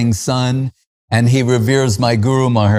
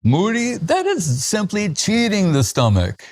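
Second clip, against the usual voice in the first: much higher, playful mock protest; Swami Maharaj teasing; 8 semitones higher than usual. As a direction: tease him like an amused elder scolding lightly. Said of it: "And Swami Maharaj would say".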